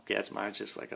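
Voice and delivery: ordinary talk, nothing marked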